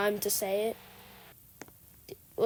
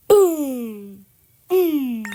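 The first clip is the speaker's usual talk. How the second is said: Vine boom sound; 10 dB louder than usual. Pretend. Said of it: "sounds like the Vine boom sound".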